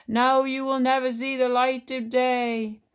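wrathful, drawn-out proclamation; thundering cave voice. A narrator's reading. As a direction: thunder it out word by word. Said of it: "thundered the voice of the cave".

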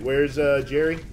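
normal talking voice

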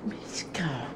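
whispered call to the dog; soft whispered name. Real, not acted. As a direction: hush the name so others sleep.

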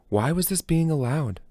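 normal talking voice

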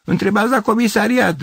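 strained high shout from a floor above; calling from upstairs. Acted. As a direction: call down to the street. Neighbour calls from upstairs.

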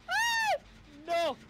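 high startled yelp and no. Real, not acted. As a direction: shriek in sudden fright. Chased by killer in game.

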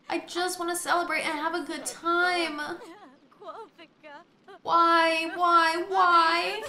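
high, hammed-up whining why; mock wail. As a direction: play the tragic victim. Mock despair after fire.

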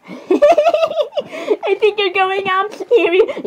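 high, slow words through laughter; giggling fit. Real, not acted. Teasing Ariel about the guy, giggling hard. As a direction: squeeze the words out between giggles.

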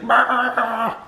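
put-on high, playful toddler "rah" roar. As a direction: speel een opgewonden peuter na. Toddler's excited greeting imitated.

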